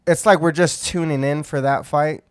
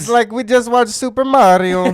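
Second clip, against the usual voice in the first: mock Italian accent; 8 semitones higher, 5 dB louder than usual. Joining the accent bit.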